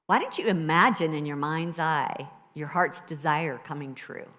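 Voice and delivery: usual speaking voice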